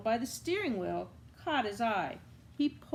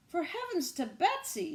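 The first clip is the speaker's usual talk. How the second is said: high, surprised exclamation; exclaiming waitress; 8 semitones higher than usual. A narrator's reading. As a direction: exclaim in shock at the cat. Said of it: "she exclaimed".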